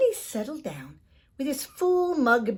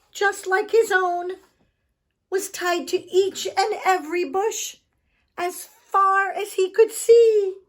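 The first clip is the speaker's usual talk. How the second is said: rising disbelief; 11 semitones higher, 9 dB louder than usual. A narrator's reading. Climax of the trick.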